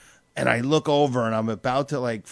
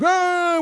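mock indignant ah; 4 dB louder than usual. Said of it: "with the hand up".

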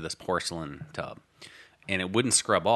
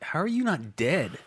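dad's astonishment; 8 semitones higher than usual. Retold or imitated. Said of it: "He was like".

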